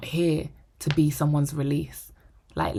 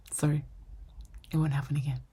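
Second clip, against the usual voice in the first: usual speaking voice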